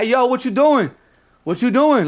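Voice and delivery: loud, high street challenge; confronting callout. Retold or imitated. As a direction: call someone out across the bar. Imitates New Yorkers calling out someone.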